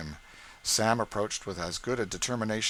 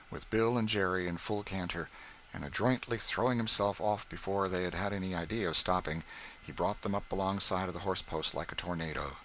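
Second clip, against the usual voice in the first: usual speaking voice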